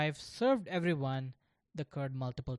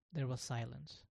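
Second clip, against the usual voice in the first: plain reading voice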